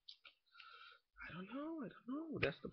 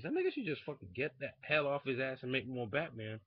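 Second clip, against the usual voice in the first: normal talking voice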